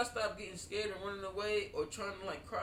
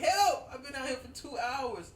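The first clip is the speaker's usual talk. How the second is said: high, loud yelp for help; comic re-enacted plea to be rescued; retold cry for help; 8 semitones higher, 4 dB louder than usual. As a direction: call out pleadingly, much higher than usual. Retold cry for help from punching bag.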